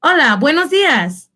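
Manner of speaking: high, lilting Spanish hello; lifted sing-out morning greeting; role-play greeting. Shown as a demonstration. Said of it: "You can greet each other different ways".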